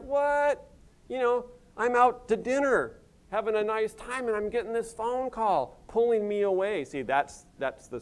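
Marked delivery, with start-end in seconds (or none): 0.0-3.6 a sudden high, startled what; exasperated what. Retold or imitated. Pastor mimics himself yanked from dinner by a call, startled. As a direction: yelp it like the phone just ruined dinner.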